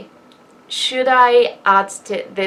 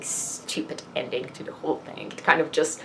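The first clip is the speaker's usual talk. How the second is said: sheepish whisper; 4 semitones lower, 7 dB quieter than usual. Real, not acted. Embarrassed aside about the ending.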